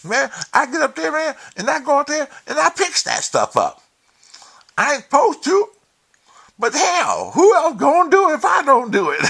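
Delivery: high, loud mock shout in the caller's voice; caller like Leonard. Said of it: "he almost talk like Leonard".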